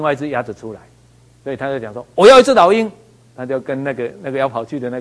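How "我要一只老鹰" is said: loud, high insistent demand. Retold or imitated. Hotel guest demands an eagle at the counter, loud and insistent.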